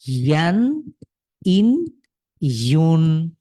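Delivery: slow, deliberate teacher chant of syllable rows; slow recitation drill. Shown as a demonstration. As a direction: lead the class through each row slowly. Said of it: "drilling tanween syllables for class".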